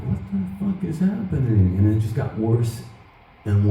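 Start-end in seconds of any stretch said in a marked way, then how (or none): 0.0-1.8 a sudden panicked what-the-; alarmed disbelief. Retold or imitated. Guitarist losing a lick onstage, alarmed disbelief. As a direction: pitch jumps much higher, a little softer.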